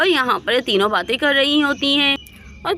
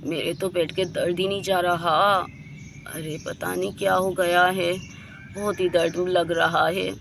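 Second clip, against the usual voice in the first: soft, low, weak with stomach ache; in pain; 5 semitones lower, 6 dB quieter than usual. Acted. Kajal, alone in bed, moaning softly about her stomach pain. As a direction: groan it out quietly, hurting.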